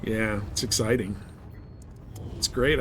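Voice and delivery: normal talking voice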